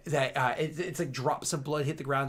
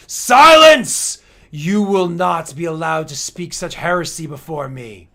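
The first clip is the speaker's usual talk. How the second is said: roaring command, much higher and louder; furious shout; 4 semitones higher, 18 dB louder than usual. Acted. Emperor shouting down the accuser, furious roar. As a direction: bellow it to drown him out.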